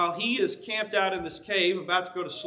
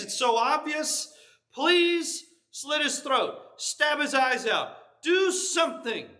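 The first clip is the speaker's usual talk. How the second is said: frantic higher voice of a soldier begging his leader to act; desperate pleading; 5 semitones higher than usual. Acted. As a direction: plead with him like a man at the end of his rope. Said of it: "you can just hear the desperation in the man's voice".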